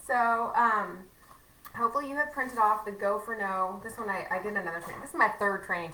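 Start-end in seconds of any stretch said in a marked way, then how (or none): none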